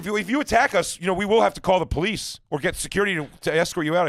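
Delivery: high, loud, mock-official threat; mock nervous clerk. Imitating staff threatening police.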